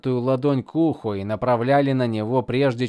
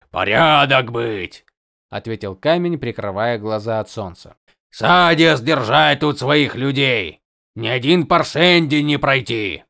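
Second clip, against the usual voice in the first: broken speech; 5 dB louder than usual. Acted. Horneater speaking broken Common.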